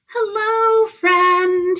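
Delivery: bright high sing-song hello, stretched out; high friendly greeting. A narrator's reading. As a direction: call out the greeting high and cheery. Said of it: "he said to the possum".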